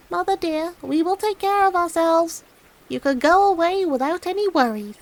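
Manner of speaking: put-on high young kid's voice; a small child reassuring its mother; little goat kid.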